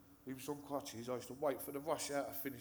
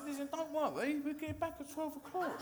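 mock coaxing; 13 semitones higher than usual. Hustler sweet-talking the one-legged man into handing over his leg, raised coaxing voice. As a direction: talk him round in a raised, pleading voice.

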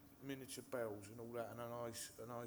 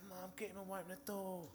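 lightly voiced brother, higher and whining; whiny older brother; 6 semitones higher than usual. Brother calling to mum to get the kid away, whining.

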